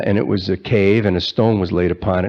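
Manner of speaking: normal talking voice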